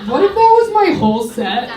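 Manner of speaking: normal talking voice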